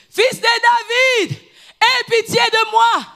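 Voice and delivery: high, slow pleading shout; crying out. Retold or imitated. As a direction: cry out to be heard, slowly. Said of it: "Il commence à crier". (cri suppliant, aigu et lent)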